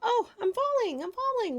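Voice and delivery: high, loud wail of alarm. A narrator's reading. A falling hat wailing high and loud. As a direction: wail as you tumble away. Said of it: "wailed the hat".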